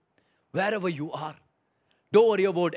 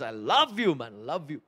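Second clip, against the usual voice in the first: hushed loving embrace in words; tender Holy Spirit voice; 3 semitones lower than usual. Pretend. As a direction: whisper love like a hug. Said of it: "gives them a bear hug and says".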